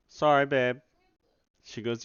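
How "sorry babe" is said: louder sheepish call out; loud apology. Real, not acted. Dev calls an apology to his sleeping girlfriend, loud. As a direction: call sorry across the room.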